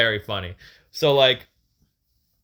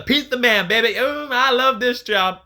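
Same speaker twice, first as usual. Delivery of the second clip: light, higher, louder pizza man promo voice; Dusty Rhodes; 10 semitones higher, 5 dB louder than usual. Retold or imitated. Lightly imitating Dusty Rhodes as the pizza man. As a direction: ham it up like an old promo. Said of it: "this is from Dusty Rhodes' promos".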